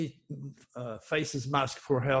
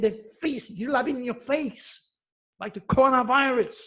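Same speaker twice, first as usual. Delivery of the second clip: getting emotional; 5 semitones higher than usual. Real, not acted. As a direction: pitch climbing higher, real fervour rising. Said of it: "Sorry, I'm getting emotional again".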